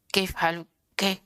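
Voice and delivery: kaif said clipped and fast like ke; rushed wrong reading. Shown as a demonstration. Teacher shows the rushed wrong reading. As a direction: cut kaif short on purpose. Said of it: "membacanya terlalu cepat".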